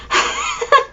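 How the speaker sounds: short high burst of laughter; a quick laugh at herself. Real, not acted. Laughing at her own dazed state, high and bright.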